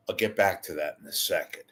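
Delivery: ordinary talk, nothing marked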